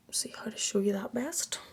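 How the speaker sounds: whispered aside, barely audible; muttering to herself. Real, not acted. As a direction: murmur it under your breath, thinking aloud. Muttering to herself while showing makeup.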